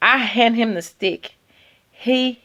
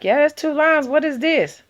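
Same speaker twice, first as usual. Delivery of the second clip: mom's voice; 9 semitones higher than usual. Retold or imitated. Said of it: "my mom was like".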